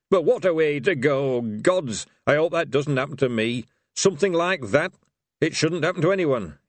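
put-on old barbarian voice. A narrator's reading. Barbarian mourning a friend.

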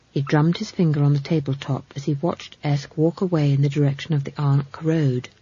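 ordinary delivery

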